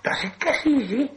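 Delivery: hissing, high creature voice; menacing Gollum. A narrator's reading. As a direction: hiss the threat, flaring high at the end. Gollum challenges Bilbo to riddle contest.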